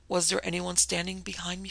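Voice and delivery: plain reading voice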